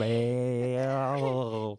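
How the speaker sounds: long loud Southern drawl on Bale; exaggerated southern accent. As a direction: stretch Bale out, hammed up. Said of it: "I'm overdoing it".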